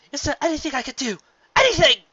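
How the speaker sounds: high, loud and fast, pleading; desperate begging. Acted. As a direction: grasp at any last hope. Luna begs the mirror.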